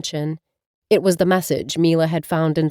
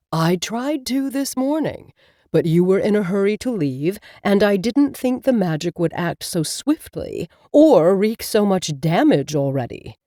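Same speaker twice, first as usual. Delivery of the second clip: talking cat Abel. Acted. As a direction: speak as the wise, patient cat familiar. Cat familiar Abel speaks.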